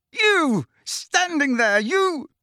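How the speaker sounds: very high, strangled accusation; furious roar. A narrator's reading. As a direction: hurl each burst at him. Harry still roaring at Dumbledore.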